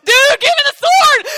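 shrieking high and loud, panicked; frantic armor bearer. Retold or imitated. Armor bearer yelling at Jonathan for his sword, frantic shout. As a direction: scream it like a sidekick in sudden battle. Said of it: "the armor bearer go".

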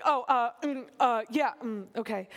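flustered, halting hems and haws; awkward mock stammer; really awkward. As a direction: act out an embarrassed man stumbling for words. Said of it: "Laban gets really awkward".

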